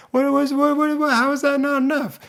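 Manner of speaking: high, stammering mock disbelief; baffled. Rejected guy questions why his compliment failed, baffled. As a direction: sputter like a bewildered suitor. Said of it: "he's baffled".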